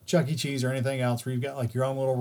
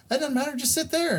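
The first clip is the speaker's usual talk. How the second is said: lightly voiced girl; 11 semitones higher than usual. Other girl voiced in story.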